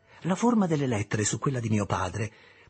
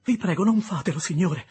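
put-on high, loud, frightened child's cry; terrified plea; 7 semitones higher, 5 dB louder than usual. Little Pip begging the convict not to cut his throat: terrified plea. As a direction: beg for your life, voice jumping up. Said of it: "invocai terrorizzato".

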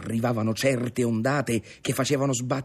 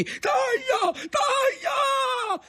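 high, drawn-out frantic yell; repeated shouted order; much slower than usual. A narrator's reading. Grandfather urging that the sail be cut, shouting. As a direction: scream each word as an urgent command. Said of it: "ripeteva il nonno".

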